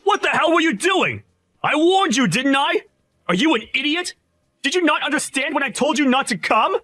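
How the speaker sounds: high furious shouting at an idiot; angry yelling. Acted. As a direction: yell at him, outraged he came. Boss yelling at fired rival.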